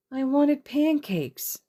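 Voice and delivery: put-on high, slow sulky child mutter. Eri pouts to Izuku about wanting pancakes, high slow mutter. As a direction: drag it out like a pouting little girl. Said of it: "she muttered".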